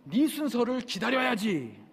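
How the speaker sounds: high, drawn-out scolding; village chief's rebuke. Retold or imitated. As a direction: imitate an official snapping back at complainers. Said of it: "앞에서 동장이".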